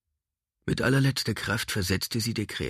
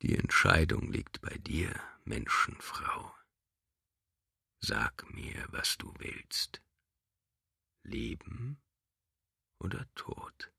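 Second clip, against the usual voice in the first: creature whisper; 5 dB quieter, slower than usual. Acted. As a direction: whisper it like a predator. Creature menaces captive woman.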